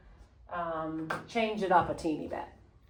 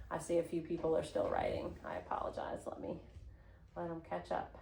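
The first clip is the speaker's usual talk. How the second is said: low aside; 3 semitones lower, 12 dB quieter, faster than usual. Real, not acted. Drops voice while students write.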